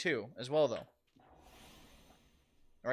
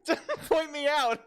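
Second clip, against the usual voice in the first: higher, louder, laughing through it; laughing protest; 9 semitones higher, 6 dB louder than usual. Real, not acted. Streamer laughing at chat calling him out. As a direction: laugh while begging them to stop.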